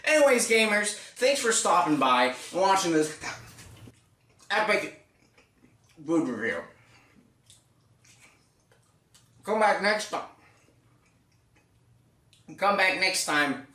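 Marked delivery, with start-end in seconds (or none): 2.5-13.7 eating mid-sentence; chewing while talking. Real, not acted. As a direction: mouth full of pizza. Seen 2: Holds pizza, bites at 3.2, chews throughout while talking.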